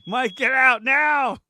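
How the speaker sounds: loud, slow, drawn-out warning yell; urgent shout. Real, not acted. Friend shouting at Mike over the smoke, loud and slow. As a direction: yell at Mike to get out now. Joking alarm at smoke, fire.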